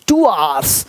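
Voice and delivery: low "टू आवर्स" with आवर्स said as aars, no v; correct pronunciation. Shown as a demonstration. Teacher models the phrase for learners, correct pronunciation. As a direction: model "टू आवर्स" with the second word merged into aars. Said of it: "टू आवर्स नहीं".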